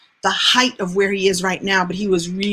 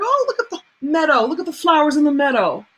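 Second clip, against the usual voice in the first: lightly mocking carefree passenger, higher pitched; 5 semitones higher than usual. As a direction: idly admire the view as you're driven along. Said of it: "he's just taking a ride. Like".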